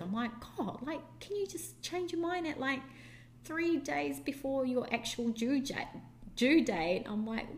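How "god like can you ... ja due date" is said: high-pitched disbelieving question; incredulous shock. Retold or imitated. Mother reacting to the midwife's offer, high disbelief. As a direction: sound floored by the idea.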